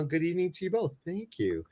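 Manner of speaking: ordinary talk, nothing marked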